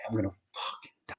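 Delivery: fast, breathless hushed whisper; scared whisper of the thought of dying; fearful whisper. Retold or imitated. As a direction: whisper it quickly to yourself.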